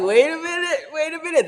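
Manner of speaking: high, laughing panic; flustered. Retold or imitated. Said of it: "Then I'm like".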